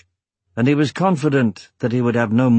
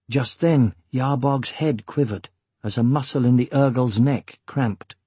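ordinary delivery, nothing marked

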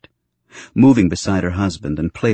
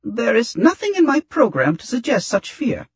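very high, squeaky droid voice; squeaky, uneven. A narrator's reading. Frightened droid denying fear to his teaser, squeaky. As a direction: protest nervously in a high robot squeak. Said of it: "his voice hadn't come out all squeaky and uneven".

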